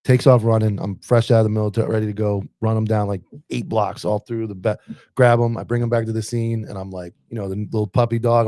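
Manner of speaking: usual speaking voice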